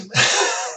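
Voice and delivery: short breathy laugh. Real, not acted. Laughs at his own okay.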